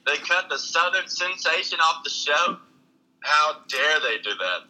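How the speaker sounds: slow, theatrical indignation; mock outrage. Comic protests being cut from the show, mock outrage. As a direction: act grandly wounded, drawing the words out.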